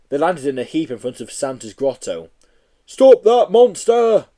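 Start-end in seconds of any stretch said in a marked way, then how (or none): 2.9-4.3 loud, slow, high cry. Acted. Policeman crying out in the shop, loud slow cry. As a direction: bellow it like a policeman. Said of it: "cried the policeman".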